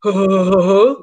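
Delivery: imitated ghostly breath. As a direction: blow a long shuddering breath. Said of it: "He was like".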